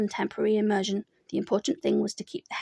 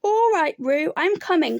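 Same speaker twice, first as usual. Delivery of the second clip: higher, louder reassuring call; 6 semitones higher, 5 dB louder than usual. A narrator's reading. Christopher Robin calls out to Roo, loud call. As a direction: shout across the stream. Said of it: "called Christopher Robin".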